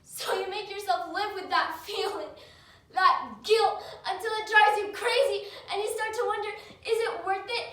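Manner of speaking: distraught and loud, swelling toward the final question; desperate outburst. Acted. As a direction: plead it out in mounting anguish. Acted monologue, despairing over guilt.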